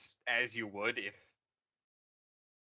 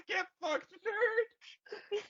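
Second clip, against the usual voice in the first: much higher, squealing mock yell; much slower than usual. Rey yelling at Kylo, squealing mock yell. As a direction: shriek it out between laughs. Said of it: "She yells".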